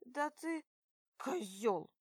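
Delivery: angry yell; angry shout. Acted. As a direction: spit it out furiously. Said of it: "выкрикиваю зло".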